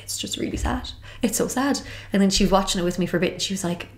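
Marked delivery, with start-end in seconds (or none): 0.0-1.8 half-breathed, much softer mock crying; imitating tearful voice. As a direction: retell the tears in a soft, breathless voice. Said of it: "I was roaring crying into my breakfast".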